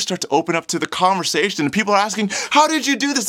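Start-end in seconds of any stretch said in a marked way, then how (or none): 2.5-3.3 very high, loud astonished question; amazed. Acted. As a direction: demand an answer in sheer amazement. Amazed crowd questioning healed man.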